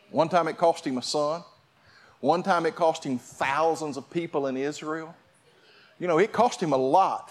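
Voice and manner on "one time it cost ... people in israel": ordinary delivery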